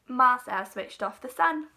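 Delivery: usual speaking voice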